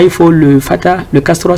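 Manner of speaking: ordinary talk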